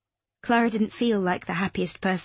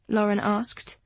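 usual speaking voice

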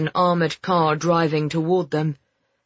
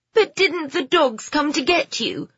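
distressed; 10 semitones higher than usual. Acted. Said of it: "the distress in her voice rising".

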